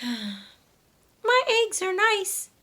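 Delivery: high, slow hen voice after a sigh; a hen trying to cheer herself up; high, slow, slightly sad hen voice; little sad hen. Acted. After a sigh, Dora thinks.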